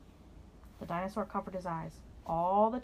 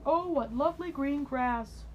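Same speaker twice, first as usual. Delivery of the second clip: delighted dinosaur; 6 semitones higher, 3 dB louder, slower than usual. A narrator's reading. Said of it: "said the dinosaur".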